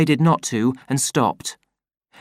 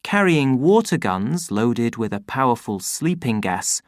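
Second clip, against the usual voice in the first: ordinary delivery, nothing marked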